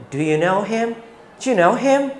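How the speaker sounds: quick, clipped native-style question with a rising end; casual native English. Shown as a demonstration. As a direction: say it like a relaxed native English speaker. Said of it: "seperti orang Inggris ngomong pada umumnya". (seperti orang Inggris bicara santai, cepat dan naik di akhir)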